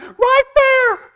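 high, piercing imitation of alarm; mock panicked shout. Mother pointing out the animal to husband, panicked shout. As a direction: shriek it as the frantic wife.

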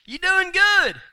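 high, cheering encouragement; encouraging Jesus. Retold or imitated. Jesus cheering Peter on across the waves. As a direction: coax him along like a proud coach. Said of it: "he's like".

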